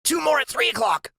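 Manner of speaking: high, urgent little voice; Freak's high voice. A narrator's reading. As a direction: warn him fast in a high voice.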